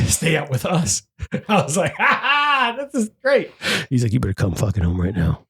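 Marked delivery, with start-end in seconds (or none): 2.0-3.5 higher, smug sing-song; lightly hammed-up glee; mock gloating. Imitating himself gloating at his partner. Gloating when roles reversed. 4.1-5.5 much lower, stern order; a hint of a bossy spouse; mock stern demand. Imitating partner ordering him home.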